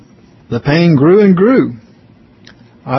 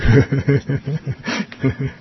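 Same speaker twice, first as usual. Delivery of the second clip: soft, breathy laugh trailing off; soft chuckle; 5 dB quieter than usual. Real, not acted. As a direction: laugh quietly at your own words. Chuckles after retelling a grim request.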